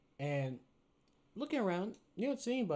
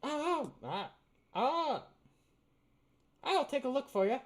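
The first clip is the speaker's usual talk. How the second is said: high goblin voice; 12 semitones higher, 6 dB louder than usual. Acted. Goblin shopkeeper answering.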